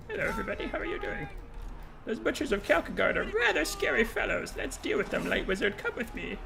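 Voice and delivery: high, soft, dainty falsetto; prissy polite voice. Acted. Dainty unit politely thanking enemies, high soft falsetto. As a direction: simper politely like a fussy gentleman.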